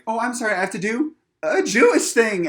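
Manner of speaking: high, loud, apologetic character voice; Andy impression. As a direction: call out a hammed-up, high-pitched apology. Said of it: "I literally heard Andy going like".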